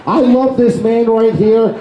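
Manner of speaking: ordinary talk, nothing marked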